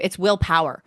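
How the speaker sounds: ordinary delivery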